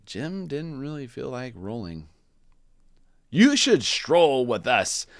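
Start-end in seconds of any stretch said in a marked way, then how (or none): 3.3-5.1 louder, slow and stately; a proud strolling invite; peacocks' voice. Acted. Peacocks inviting Jim, slow and loud. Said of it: "said the peacocks".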